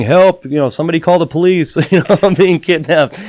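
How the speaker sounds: hammed-up retold yell, half laughing; mock scream for help. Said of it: "I'm, like, screaming".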